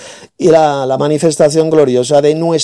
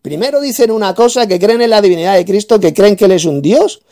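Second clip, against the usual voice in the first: raised, higher-pitched tirade; angry rant; 5 semitones higher than usual. Real, not acted. Debater berating his opponent as a fool, angry and raised. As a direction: lash out at the opponent.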